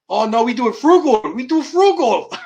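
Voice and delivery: high, loud, badly faked Indian accent. India's space people defending their frugal project, bad Indian accent. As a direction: insist in a hammed-up Indian accent. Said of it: "I can't even do an Indian accent".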